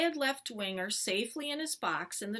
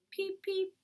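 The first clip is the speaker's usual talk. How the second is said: two high little chirps; baby bird peep; 11 semitones higher than usual. Acted. Bird replying to boy's invitation to sleep, bird peep. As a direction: peep back like a small bird.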